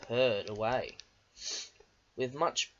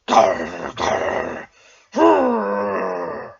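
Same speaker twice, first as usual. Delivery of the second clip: roaring growl; 5 semitones higher, 12 dB louder, much slower than usual. A narrator's reading. Diesel straining at the stuck trucks, loud roar. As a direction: roar in frustration. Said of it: "roared Diesel".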